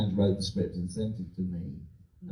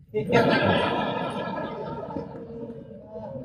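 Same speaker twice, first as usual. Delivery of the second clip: laughing; 14 semitones higher than usual. Real, not acted. Big laugh after weed joke.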